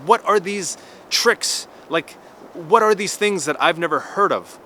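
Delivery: pissed-off outburst. Retold or imitated. Losing a chess game to a rule he never knew, exasperated. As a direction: rail at these unknown tricks. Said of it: "I was really pissed".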